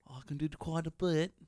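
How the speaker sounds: a comic's overdone British line; mock British accent. Shown as a demonstration. Friend doing a British accent to tease the comic. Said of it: "trying to do British accents".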